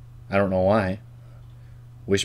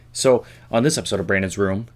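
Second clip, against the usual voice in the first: ordinary talk, nothing marked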